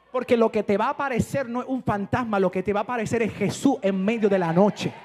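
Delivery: loud, fervent preaching, slowing on key lines; full-force preaching. Acted. Pastor rousing the congregation, loud fervent preaching. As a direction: preach it out to the whole church.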